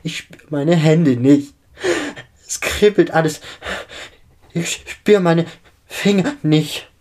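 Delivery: high, loud gasped phrases; panicked hyperventilating. A narrator's reading. Injured girl losing feeling in her hands, panicked. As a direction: gasp out each phrase in panic, out of breath. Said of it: "atmet die verletzte junge Dame unkontrolliert".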